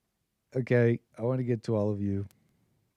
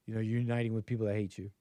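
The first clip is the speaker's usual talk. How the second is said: ordinary talk, nothing marked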